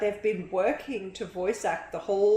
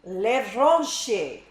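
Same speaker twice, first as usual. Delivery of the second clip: Levranche said the French way, lev-RAHNSH-ay, loud and falling; a first stab at a French accent on Levranche; viewer's request, say Levranche in a mock French accent; fake French accent; 5 dB louder than usual. Shown as a demonstration. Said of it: "Pronounce my name in a fake French accent".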